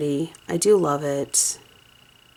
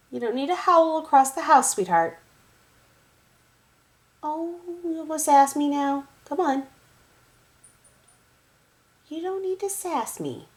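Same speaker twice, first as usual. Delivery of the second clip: pet-talk scolding; 10 semitones higher than usual. Real, not acted. Owner chiding her sassy cat in high baby talk. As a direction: tease the cat for sassing.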